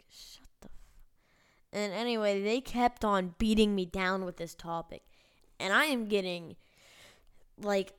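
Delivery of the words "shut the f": barely voiced, slow mock comeback; whispered retort. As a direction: whisper it slowly and cut it off. Retold muttered comeback, cut short.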